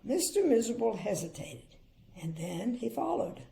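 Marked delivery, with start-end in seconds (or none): none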